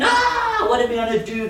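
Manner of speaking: high mock shriek breaking into a laugh; mock panicked scream. Preacher retelling the storm, sailors screaming in mock panic. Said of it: "People are screaming".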